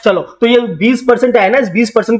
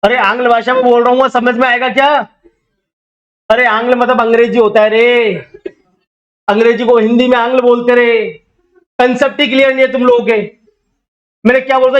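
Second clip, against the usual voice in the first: ordinary talk, nothing marked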